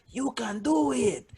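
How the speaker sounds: high, slow cheer without the volume; mock encouraging shout. Audience member encouraging a struggling comic, mock would-be shout. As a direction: draw it out like a pitying supporter. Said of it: "almost wants to shout".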